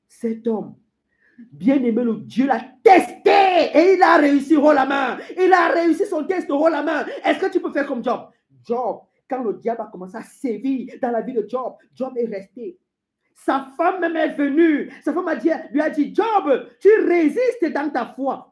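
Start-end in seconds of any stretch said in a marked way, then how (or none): 2.0-8.3 loud, rising proclamation; forceful preaching. Acted. Preacher holding up Job as example, forceful. 13.3-18.5 high-pitched, emphatic storytelling; forceful preaching. Acted. Preacher retelling Job's wife challenging him, forceful.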